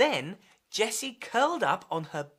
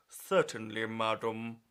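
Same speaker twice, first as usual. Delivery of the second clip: lower, formal waiter voice; posh waiter; 10 semitones lower, 4 dB quieter than usual. Acted. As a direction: answer like a stiff waiter. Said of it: "said the waiter".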